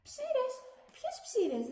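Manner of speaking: high, shocked question; indignant surprise. A narrator's reading. Water glass shocked at being accused of lice, high and indignant. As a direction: yelp in disbelief at the insult.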